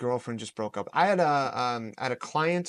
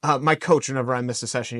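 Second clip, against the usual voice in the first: ordinary delivery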